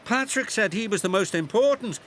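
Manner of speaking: breathless and fast, a touch of panting; panted. A narrator's reading. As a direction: hurry the words out as if out of breath. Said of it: "panted Alfie".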